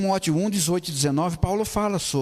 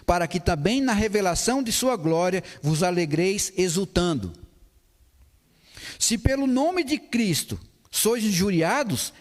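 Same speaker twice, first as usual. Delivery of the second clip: scripture reading; 4 semitones higher than usual. Acted. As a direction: read each verse with weight. (leitura bíblica solene e pausada)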